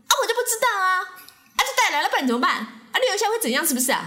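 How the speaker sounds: high, shrill-edged defiant auntie voice; hammed-up auntie snapping back. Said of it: "她就说".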